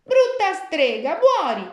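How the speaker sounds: all her rage in two words; girl's furious shout. Acted. Gretel, pushing the witch into the oven, shouting furiously. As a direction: shout it high and loud at the witch. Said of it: "la bimba disse".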